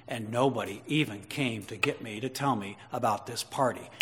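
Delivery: put-on resentful older brother grumbling to himself; sulking older brother. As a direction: mutter it like a bitter, jealous brother. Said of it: "what the older brother heard".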